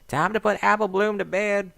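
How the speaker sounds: put-on high, loud crackly old lady; old granny calling. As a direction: call down like a grandma.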